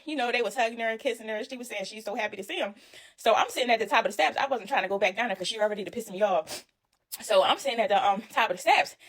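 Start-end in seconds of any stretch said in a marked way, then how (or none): none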